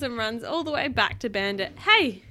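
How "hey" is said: loud, high startled shout; surprised hey. A narrator's reading. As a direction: yelp in surprise. Dad surprised by returning child.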